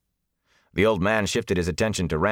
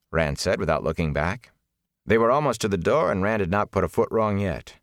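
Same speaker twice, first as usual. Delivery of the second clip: ordinary delivery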